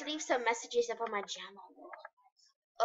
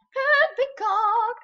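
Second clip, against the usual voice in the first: high, slow, loud delighted repeat; excited; 11 semitones higher, 8 dB louder, much slower than usual. Real, not acted. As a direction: savor the words in delight.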